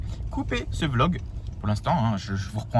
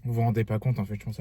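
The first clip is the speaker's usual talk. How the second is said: ordinary delivery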